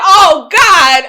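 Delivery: loud, high, slow wail; hammed-up panic. Traveler forced to leave her seat, hammed-up loud despair. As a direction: cry it out in comic despair.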